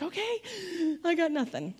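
mock-helpless; clueless. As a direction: play at a loss, pitching it up. Recalls being at a loss teaching.

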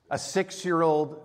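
usual speaking voice

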